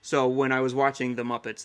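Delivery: ordinary talk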